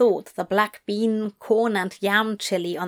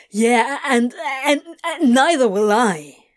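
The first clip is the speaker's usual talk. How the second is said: spluttering; 4 semitones higher, 5 dB louder than usual. Acted. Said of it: "Crowley spluttered".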